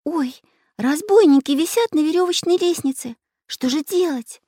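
high-pitched frightened voice; Настенька horrified. A narrator's reading. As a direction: gasp out the bad news in dismay, high. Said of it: "в ужасе отшатнулась". (испуганно, тонким голосом)

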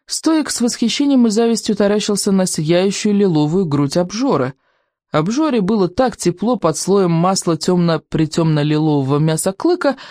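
ordinary delivery, nothing marked

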